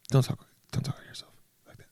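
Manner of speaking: hushed whisper to yourself; whispered aside; whispered self-scolding. Pretend. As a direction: scold yourself under your breath.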